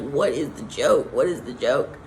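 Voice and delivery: low, expectant repeated question; mock-puzzled, low and eager; eager anticipation. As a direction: ask as if waiting to be let in on the joke. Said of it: "you're just, like, anticipating, looking like".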